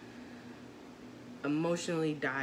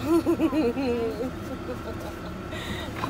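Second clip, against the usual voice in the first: laughing; 7 semitones higher, 7 dB louder than usual. Real, not acted. Laughing with her son.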